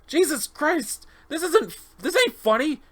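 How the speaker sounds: very high, alarmed exclamation; panicked protest. Acted. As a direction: cry out that the joke has gone too far. Subject panics at the painting.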